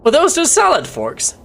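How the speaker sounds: sudden high incredulous question; surprised. Real, not acted. Streamer suddenly surprised by the salad forks. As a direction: jump up in pitch in disbelief.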